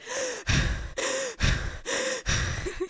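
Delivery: high, gasping panic breaths; panicked breathing. Retold or imitated. Rider at the top of the slide, panicked gasping. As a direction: act out hyperventilating in repeated gasps. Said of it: "I'm having a panic attack".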